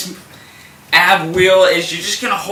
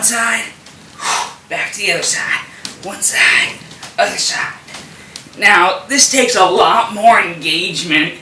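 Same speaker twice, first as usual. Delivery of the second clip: strained with effort. Real, not acted. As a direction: voice tight from holding a twist. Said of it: "hear it in my voice".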